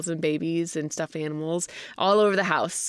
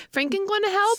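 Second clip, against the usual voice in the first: put-on high little toddler question; Evie, asking; 14 semitones higher than usual. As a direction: imitate a small child asking, pitched up. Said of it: "and she's like".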